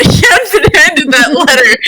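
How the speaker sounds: giggling through the correction; amused at own mistake, laughing. Real, not acted. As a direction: laugh while fixing the slip.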